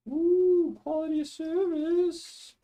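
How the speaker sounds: swooping high, drawn-out ooh of mock glee; playful delight. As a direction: savour the topic name like a treat. Gushes over the next topic.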